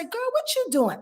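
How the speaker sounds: put-on bright high "girl" greeting, casual; chatty girlfriend. Calling a friend to ask what she's doing, high chatty voice.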